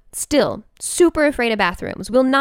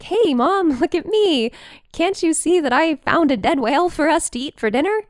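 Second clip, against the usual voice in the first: higher, eager voice for the dog showing off; proud dog voice; 7 semitones higher than usual. Retold or imitated. Dog brags to Mom about the dead whale, proud and higher. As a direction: play the dog bragging about her find. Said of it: "would come back home really proud, like".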